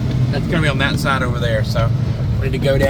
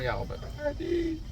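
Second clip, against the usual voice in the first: drawn-out Alabama "y'all", pitched way up; hammed-up Southern accent; 13 semitones higher, 13 dB quieter, slower than usual. Real, not acted. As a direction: lean into the Southern twang on "y'all". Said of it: "I got a real Southern accent".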